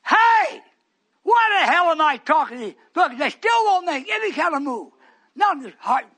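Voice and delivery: loud, high fervent preaching; preaching at full force. Real, not acted. Preacher exhorting congregation at full force. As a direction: drive it home to the pews.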